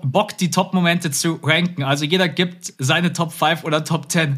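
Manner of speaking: usual speaking voice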